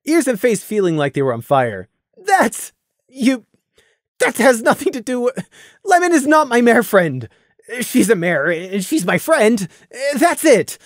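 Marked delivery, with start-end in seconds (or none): 2.2-10.8 high, flustered spluttering denial. Acted. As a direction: stammer it out, face burning. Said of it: "Randy spluttered".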